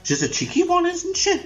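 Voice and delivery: high squealed cheeky cow; shrill camp indignation; camp mock outrage. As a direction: shriek it with mock offence.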